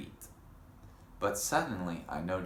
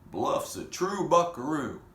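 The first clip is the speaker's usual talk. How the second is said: louder, higher cheer; cheering cowboys; 8 semitones higher, 6 dB louder than usual. A narrator's reading. Cowboys cheering the hero, loud and high. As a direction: whoop it like a crowd of cowboys.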